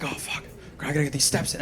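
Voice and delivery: quiet, tense mutter under his breath; muttering to himself. Retold or imitated. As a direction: grumble it low to yourself. Frantic muttering on platform.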